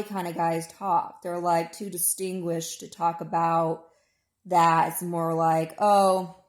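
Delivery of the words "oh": ordinary talk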